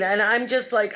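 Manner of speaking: normal talking voice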